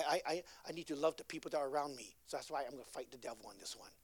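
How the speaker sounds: ordinary talk, nothing marked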